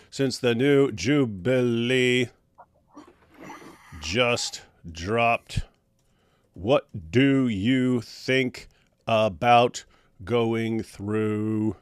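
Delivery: words drawn out slowly as he types; slow dictating while typing. Real, not acted. Reading his message aloud as he types it, slowly. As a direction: speak at typing speed. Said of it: "gosh, I type slow".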